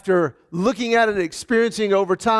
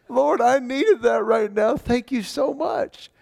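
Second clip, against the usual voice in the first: higher, slower thanks through leftover laughter; laughing thanks; 6 semitones higher, slower than usual. Retold or imitated. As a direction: still laughing while thanking God. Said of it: "God just made me laugh".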